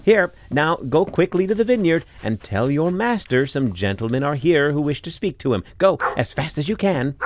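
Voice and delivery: high, loud flustered housewife; wife's voice. A narrator's reading. Wife sending the robbers off to the vineyard, high and loud.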